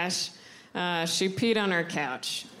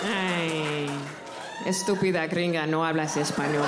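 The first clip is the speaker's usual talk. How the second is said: great-grandmother mocking her for not speaking Spanish, lower; great grandma scolding; 5 semitones lower than usual. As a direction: lower old abuela, mock scornful. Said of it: "my great grandma's voice".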